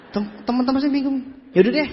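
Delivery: ordinary talk, nothing marked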